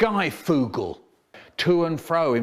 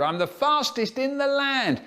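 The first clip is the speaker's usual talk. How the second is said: high-pitched boastful cry; excited yell; 10 semitones higher than usual. A narrator's reading. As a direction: yell the boast while running off. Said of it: "Miss Goodall yelled".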